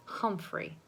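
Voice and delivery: whisper. A narrator's reading. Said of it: "she added".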